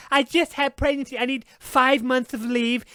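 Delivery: mock a pampered complainer, stretch "leave" slowly; mock entitled whine. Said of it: "these women are like".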